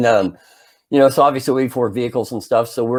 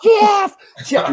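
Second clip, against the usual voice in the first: very high, louder frantic call; mock yelling; 3 dB louder than usual. Boy trying to warn his friend on the porch, high urgent mock yell. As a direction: yell the name urgently, pitched way up. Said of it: "and I'm yelling".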